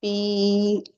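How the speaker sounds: "पी" with a long, sustained "ee"; "पी" held for two counts; ya madd drill. Shown as a demonstration. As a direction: stretch "पी" on a level tone. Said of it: "या मद्दा की मश्क".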